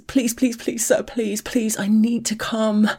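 high rushed pleading, breaking into whisper; frantic high-pitched begging to come; desperate begging. Acted. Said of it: "start begging incoherently".

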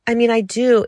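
flustered eager protest; nervous insistence. A narrator's reading. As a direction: push it out in a fluster. Nervous servant over-insisting.

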